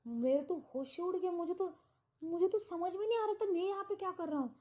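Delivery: a man reliving the horror of seeing two killings; panicked rush. Retold or imitated. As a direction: gabble it out in shock. Narrator relives panic after murders.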